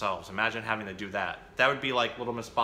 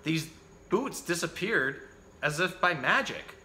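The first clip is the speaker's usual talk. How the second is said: put-on lively picture-book reading for children; animated bedtime-story reader; story narration; 3 semitones higher than usual. A narrator's reading. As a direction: read the story out with bouncy, emphatic phrasing.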